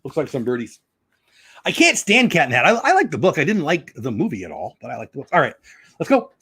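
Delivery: ordinary delivery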